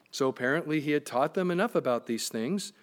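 ordinary talk, nothing marked

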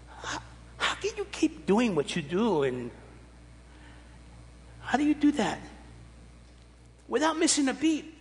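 a hint of an eager daughter asking Dad; high, curious kid-daughter question; imitating his daughter. Daughter asking about his energy.

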